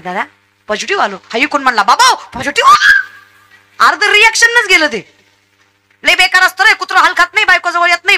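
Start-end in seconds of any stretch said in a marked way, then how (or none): 0.0-1.1 retold in a low, soft voice; imitating a hushed admission on the phone; subdued confession. 1.8-3.9 drawn-out, high-pitched shout of alarm; hammed-up panic, stretch the last word into a cry; mock horrified yell. Mocked horrified reaction to the news.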